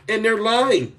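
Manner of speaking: ordinary talk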